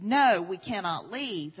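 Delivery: a quoted man's resolute no; firm refusal. Retold or imitated. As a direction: plant your feet and refuse. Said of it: "And the man said".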